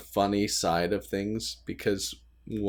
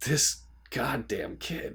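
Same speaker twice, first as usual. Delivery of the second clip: slow and softer, half under the breath; mock exasperated mutter; 2 semitones higher, 6 dB quieter, slower than usual. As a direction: grumble it like a worn-out parent. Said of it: "there are moments where it's like".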